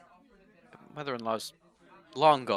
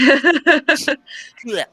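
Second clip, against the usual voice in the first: loud, high noise of disgust; high-pitched, exaggerated yuck noise; disgusted blech; 12 semitones higher, 20 dB louder than usual. Spitting out chewing gum in disgust.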